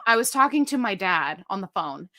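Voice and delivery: usual speaking voice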